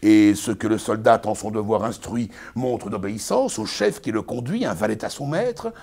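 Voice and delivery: pompous Arnolphe, lecturing his bride; booming, sermonizing stage declamation; hammy stage actor. Acted. Performs Arnolphe's tirade.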